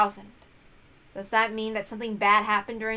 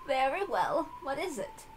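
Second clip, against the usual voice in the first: put-on higher old-woman laugh, then a gentle answer; old servant chuckling; 7 semitones higher than usual. Servant Kumasawa answers her mistress, lightly voiced with a chuckle. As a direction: chuckle kindly, then invite the question.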